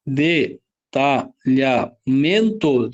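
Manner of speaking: detalhamento very slowly as de, ta, lha, men-to; syllable by syllable. Shown as a demonstration. Teacher finding digraphs, detalhamento in slow separate syllables. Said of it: "sempre tem que separar".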